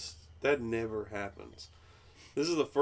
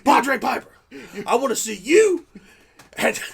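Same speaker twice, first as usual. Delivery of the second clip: loud, high promo shout; wrestling promo; 13 semitones higher, 14 dB louder than usual. Acted. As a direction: hype the challenge. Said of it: "wrestling promo".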